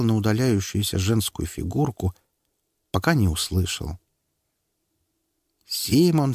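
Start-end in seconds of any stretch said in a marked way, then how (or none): 5.7-6.3 high call, falling. Acted. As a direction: call his name. Teacher calls out.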